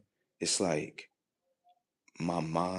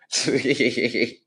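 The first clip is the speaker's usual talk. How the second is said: loud high burst of laughter; laughing; 6 semitones higher, 8 dB louder than usual. Real, not acted. Amused at wanting more of disobeying, loud laughter. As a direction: crack up loudly, pitched up high.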